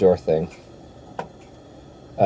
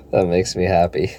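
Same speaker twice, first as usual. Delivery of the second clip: usual speaking voice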